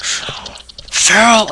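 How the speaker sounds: long, high-pitched name call; mock outraged call. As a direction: draw the name out high, as if scolding. Acting Austin's outraged reaction.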